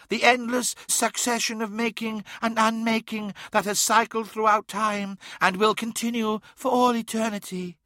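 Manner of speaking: high-pitched grandiose daemon voice; otherworldly tempter. A narrator's reading. Daemon tells Magnus of eternal chaos, high and grandiose. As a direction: proclaim it like an ancient power, pitched high.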